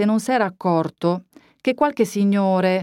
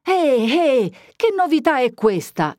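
high, alarmed "eh, eh" of a cornered priest; startled priest; 8 semitones higher than usual. A narrator's reading. Startled priest to young man bursting into his parlour, alarmed. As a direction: jump in alarm at the intruder.

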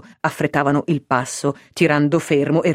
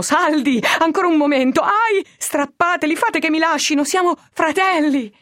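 shouting cheer; 11 semitones higher than usual. A narrator's reading. Prisoner cheering on his rescuers, high shout. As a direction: egg them on, shouting. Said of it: "gridava Fermo".

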